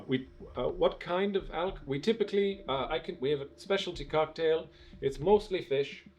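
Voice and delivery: lightly voiced bartender. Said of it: "the bartender says".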